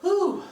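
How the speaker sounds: high loud puff of relief; breathless whew. Real, not acted. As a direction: blow out a winded whew. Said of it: "Let me catch my breath".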